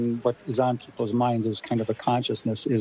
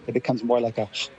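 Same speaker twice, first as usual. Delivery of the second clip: normal talking voice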